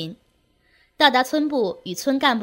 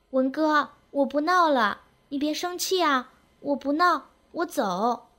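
put-on high, meek girlish promise; calmed-down girl; 4 semitones higher than usual. A narrator's reading. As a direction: plead obediently in a high little voice. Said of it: "立即静了下来".

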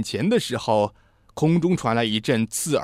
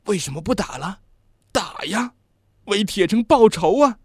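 bewildered outcry; 6 semitones higher than usual. A narrator's reading. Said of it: "他惊讶地从担架上挣扎起来".

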